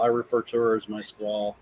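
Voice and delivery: ordinary talk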